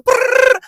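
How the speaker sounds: high, loud rolled brrr; buzzing punch-barrage sound effect; rapid-fire punch noise. Retold or imitated. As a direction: imitate a flurry of punches with a loud trilled burst.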